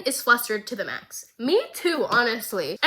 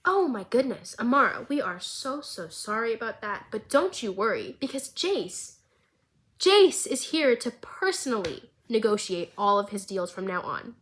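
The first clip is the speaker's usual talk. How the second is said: normal talking voice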